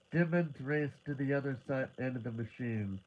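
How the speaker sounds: plain reading voice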